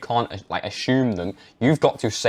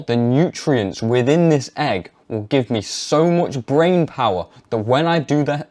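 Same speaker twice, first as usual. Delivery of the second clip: full throttle conviction. Shown as a demonstration. Demo of telling parents about eggs, full conviction. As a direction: sell it like undeniable fact. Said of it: "go full throttle".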